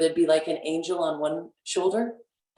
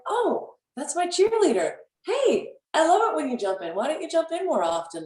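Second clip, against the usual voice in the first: high, gushing hello to an inner cheerleader; higher, lightly playful delight; delighted welcome; 11 semitones higher than usual. Pretend. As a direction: greet your cheerleader like a friend you missed.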